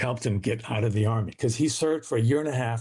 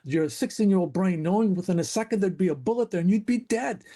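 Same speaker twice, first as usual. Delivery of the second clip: put-on little kid asking; 7 semitones higher than usual. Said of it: "I remember saying".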